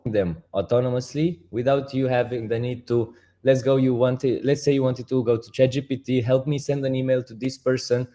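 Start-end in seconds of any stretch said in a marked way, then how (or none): none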